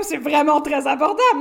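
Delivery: loud, exaggerated delight at a bargain; mock amazement. Host jokes about the coffee's price, mock amazed. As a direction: exclaim it as if thrilled by the price. Retells own over-enthusiastic reaction to price.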